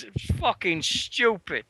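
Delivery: usual speaking voice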